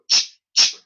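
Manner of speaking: sharp unvoiced ch sounds; voiceless ch bursts. Shown as a demonstration. Teacher demonstrating a noisy ch. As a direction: spit out ch with no voice. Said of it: "C H".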